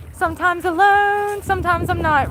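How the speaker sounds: Hello Kitty voice. Pretend. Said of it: "In my Hello Kitty voice".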